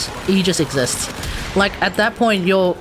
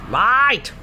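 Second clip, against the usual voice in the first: a hint of a broad Australian bogan, higher; full bogan accent; 5 semitones higher than usual. Vlogger puts on his full bogan voice, lightly Australian. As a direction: bark it out like an Aussie bogan. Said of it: "put on my full bogan".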